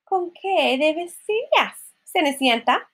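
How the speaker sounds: high, drawn-out scornful questions; scornful stepmother. Acted. Stepmother to Cinderella about her dress, slow high scorn. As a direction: stretch each scornful jab, pitched high.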